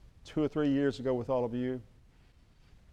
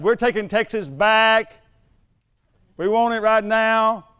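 loud, high, slow pretend proclamation; mock demand; 7 semitones higher, 8 dB louder, slower than usual. Mexico announcing it takes Texas back, mock-forceful demand. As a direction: declare it like a mock invader, stretching the words.

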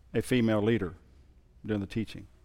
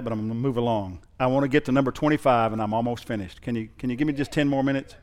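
ordinary talk, nothing marked

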